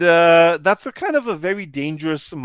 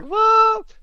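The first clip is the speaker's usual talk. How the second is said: very high, loud mock squawk; astonished question; 8 dB louder than usual. Wife hearing her husband is famous, astonished. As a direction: imitate a wife in utter disbelief.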